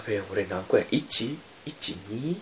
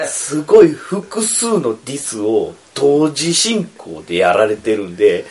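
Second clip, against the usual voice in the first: slow, laughing through it; chuckling while getting the words out; 4 dB louder, slower than usual. Real, not acted. Being teased by everyone at once.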